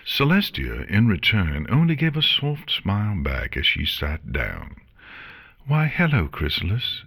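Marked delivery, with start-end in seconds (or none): 5.7-7.0 high, lilting cheerful greeting; breezy, cheery hello. A narrator's reading. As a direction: greet them brightly, pitched up then dropping on the name. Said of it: "she replied cheerfully".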